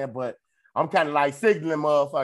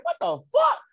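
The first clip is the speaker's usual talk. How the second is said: shocked what; 4 dB louder than usual. Retold or imitated. Reacting to mom's story.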